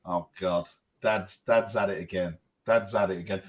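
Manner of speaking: low, quiet exasperation; imitated weary kids. Kids seeing dad's smile, imitated weary low aside. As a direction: mutter it in resigned dismay. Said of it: "they're like".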